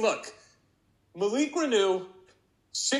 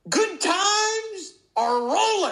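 grand celebration; 11 semitones higher, 4 dB louder, slower than usual. Acted. Hammed-up celebration announcement.

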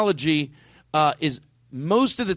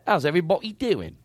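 Cockney greeting, dropped aitch. Shown as a demonstration. Host modelling Cockney for Aristotle. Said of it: "do the Cockney first".

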